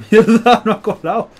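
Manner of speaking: laughing curse, high and loud. Real, not acted. Busted, laughing at own failed trick.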